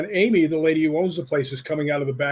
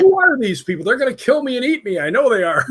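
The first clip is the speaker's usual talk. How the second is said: mock panic; 7 semitones higher, 6 dB louder than usual. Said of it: "And I'm like".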